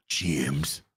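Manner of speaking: deep, soft Yorkshire trying to sound posh; very low, quiet actor's impression of one name; Sean Bean. Streamer showing the key word, deep Sean Bean impression. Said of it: "You gotta get that".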